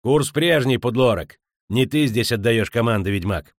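forceful countermanding shout; barked order. Acted. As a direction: overrule him sharply as captain. Captain countermands the witcher's order.

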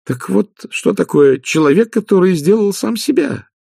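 high, excited realisation building to the end; exclaiming. Acted. Magrat realises Igor is self-made, high and exclaiming. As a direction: burst out with the discovery. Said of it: "воскликнула Маград".

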